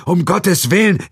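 shouted, very high and loud; horrified cry. Acted. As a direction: cry out in shock. (entsetzter lauter Aufschrei)

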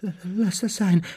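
high, scared plea; whimpering high voice; frightened stammer. Acted. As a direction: stutter it fearfully. Dudley frightened in the dark.

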